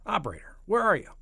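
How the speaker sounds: high, loud call into the phone; impatient calling out. Acted. President calling for the missing operator, impatient.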